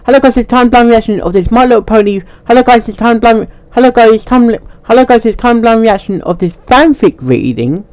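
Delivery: cheery channel intro; upbeat intro voice. Acted. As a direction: sell the opening like a host. Repeated intro takes.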